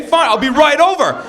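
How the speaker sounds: high, loud mock bravado; hammed-up rising indignation; fighting back. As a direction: build to a yelled threat. Accepts the challenge to fight.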